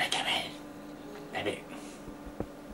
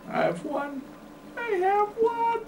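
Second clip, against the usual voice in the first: squeaky gloat; 4 dB quieter than usual. Acted. Gloating over a diamond.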